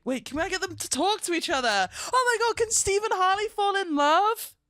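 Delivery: high-pitched rush of excitement; excited. Real, not acted. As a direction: burst out with a thrilling idea, pitch climbing. Thrilled idea of puppet romance.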